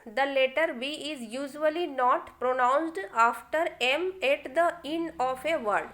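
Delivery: reading the rule very slowly. Shown as a demonstration. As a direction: dictate the rule word by word to the class. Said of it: "rule one". (बहुत धीरे नियम पढ़ना)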